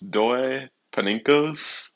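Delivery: "Doe Paninkos?" slow, each word rising like a question; attempted pronunciation. Shown as a demonstration. Streamer trying to pronounce a viewer's name, tentative questioning. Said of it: "let me try to pronounce your name".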